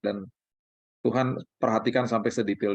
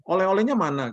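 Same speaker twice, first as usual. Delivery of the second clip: put-on eager child; 6 semitones higher than usual. Children demanding souvenirs.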